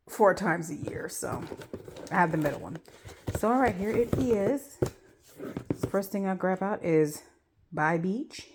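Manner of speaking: ordinary talk, nothing marked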